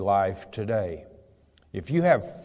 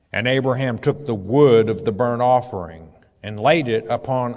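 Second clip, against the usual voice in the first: raised, measured Bible reading voice; scripture reading; 2 semitones higher, 4 dB louder than usual. Acted. Preacher reading Genesis aloud to his flock, solemn reading voice. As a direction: read the verse out to the congregation.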